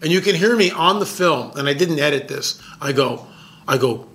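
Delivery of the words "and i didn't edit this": ordinary talk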